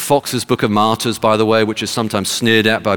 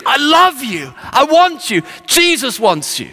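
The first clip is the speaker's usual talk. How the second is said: high-pitched imitated evangelist's cry; street preaching. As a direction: call out urgently, like preaching on the street. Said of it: "He's preaching like this on the streets".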